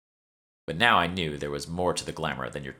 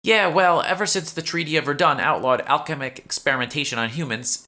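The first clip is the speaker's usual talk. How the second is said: a touch higher, chatty shop clerk; lightly voiced shopkeeper; 9 semitones higher, 5 dB louder than usual. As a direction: play the eager young shopkeeper, slightly raised. Nikki explains treaty.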